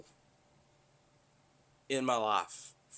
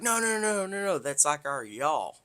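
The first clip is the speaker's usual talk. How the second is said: high, louder imitation of a woman correcting; rapid-fire high denials, easing down into explanation; hasty correction; 6 semitones higher, 5 dB louder than usual. Local woman to the confused newcomer, hastily correcting him.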